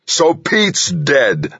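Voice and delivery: slow, high-pitched angry roar. A narrator's reading. Delaney jumps up and roars at Harry, roaring. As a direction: roar it, stretching the words out. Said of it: "Delaney roared".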